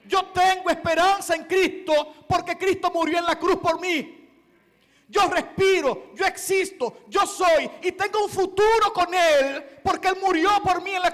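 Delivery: loud, high preaching that swells to shouts, punching each no; impassioned preaching. Acted. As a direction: declare it with mounting fervor. Preaching at full force.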